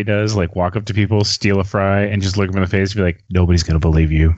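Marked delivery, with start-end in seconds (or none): 3.3-4.3 deadpan prankster. Acted. Acting out Bill Murray's prank line.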